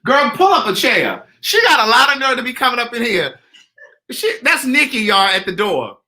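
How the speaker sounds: loud, high playful scolding; excited, teasing. Real, not acted. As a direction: tease the guest loudly. Teasing a visitor.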